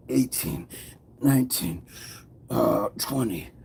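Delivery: soft, effortful counting between breaths; counting through push-ups. Real, not acted. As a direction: count out each push-up as you do it. Counting while doing push-ups.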